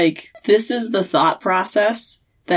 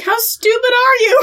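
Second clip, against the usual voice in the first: mock Dean. Dean berates Sam, acted.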